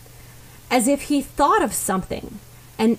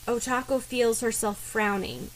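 ordinary delivery

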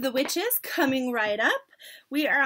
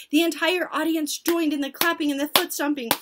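plain reading voice

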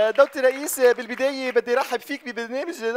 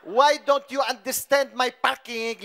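put-on Arabic-accented English; much slower than usual. Slow broken English.

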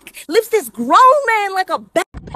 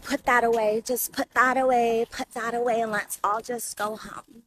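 put-on soft, low, slurring drunk coax; low and soft, a drunk girl calming an armed man; lazy drunk girl; 7 semitones lower, 11 dB quieter than usual. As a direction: soothe him like a sleepy drunk girl. Drunk girl calms man with weapon.

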